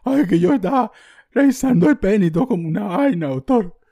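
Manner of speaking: hammed-up broken voice calling the doctor; voice breaking. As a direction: plead like a scared kid about to cry. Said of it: "con la voz toda quebrada".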